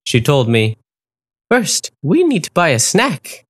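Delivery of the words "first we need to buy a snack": high, encouraging flamingo voice; flamingo Dahlia. A narrator's reading. Dahlia encouraging the wolf, high and kind. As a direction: lift the voice like a kind bird.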